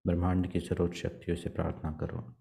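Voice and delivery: soft, even meditation voice with long pauses; guided meditation. Acted. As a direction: guide them gently into prayer, leaving long silences. Leads meditation and prayer. (शांत, धीमे स्वर में ध्यान का निर्देशन)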